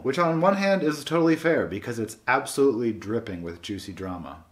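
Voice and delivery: ordinary talk, nothing marked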